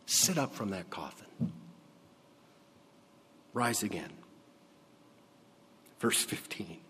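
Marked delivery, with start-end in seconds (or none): 0.1-4.1 soft, half-whispered wake-up; hushed urging. Acted. Jesus gently urging the young man to rise, soft and hushed. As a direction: coax him up in a hush.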